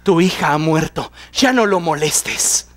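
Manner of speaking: a lightly voiced bearer of bad news; grim messenger. Messenger telling Jairo his daughter died, somber and low. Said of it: "llega alguien de la casa de Jairo y le dice".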